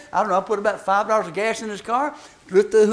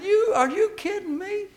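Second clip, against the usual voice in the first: incredulous; 10 semitones higher than usual. Retold or imitated. Incredulous retort.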